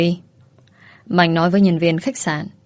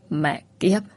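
low, softer snarled curse; 3 semitones lower, 5 dB quieter than usual. Acted. Mạnh curses at the woman who bit him, low and soft.

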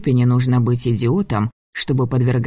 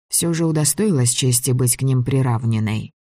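ordinary delivery, nothing marked

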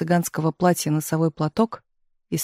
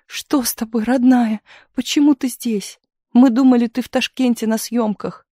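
tearful; 6 semitones higher than usual. A narrator's reading. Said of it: "плакала Таисия".

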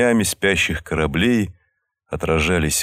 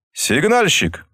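raised call into the dark; 10 semitones higher, slower than usual. A narrator's reading. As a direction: call it out into the darkness. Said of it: "крикнул он в темноту".

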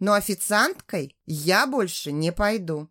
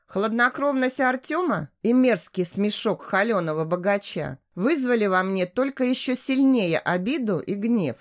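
usual speaking voice

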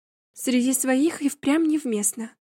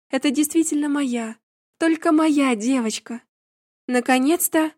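ordinary delivery

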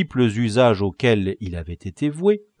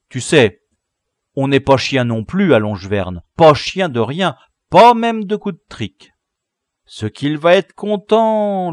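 put-on loud, higher, delighted boy's voice; gloating boy leader; 6 semitones higher, 8 dB louder than usual. Leader gloating over captive's braces, loud glee. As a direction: crow over the loot, mock-generous.

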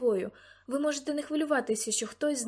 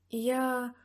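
usual speaking voice

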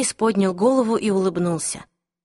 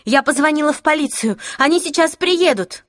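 high, raised urgent warning; alarmed threat; 7 semitones higher, 4 dB louder than usual. Acted. Clary threatening the attackers with police, urgent cry. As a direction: call it out high to scare them off.

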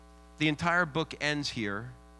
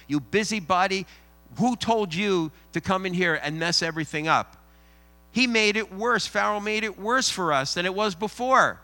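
loud, higher scolding crowd; angry crowd; 3 semitones higher, 4 dB louder than usual. Retold or imitated. The people scolding Moses as a troublemaker, loud and angry. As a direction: chase the troublemaker away with loud scorn.

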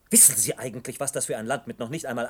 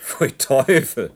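disgusted; 5 semitones higher, 9 dB louder, much slower than usual. Acted. Reacts to boiled flower tea.

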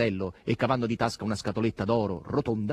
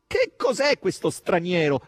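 loud, high, drawn-out cry of dismay; dismayed exclamation; 9 semitones higher, 7 dB louder, slower than usual. A narrator's reading. Margherita alarmed about the stranger, loud dismay. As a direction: exclaim so passers-by would turn round. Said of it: "a voce così alta".

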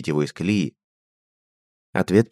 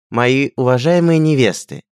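slow, loud, higher formal address; proclaiming to hall; 8 semitones higher, 6 dB louder, slower than usual. A narrator's reading. Prince addresses his brides from the pedestal, proclaiming. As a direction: ring out to the whole hall. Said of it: "голос Альберта огласил зал".

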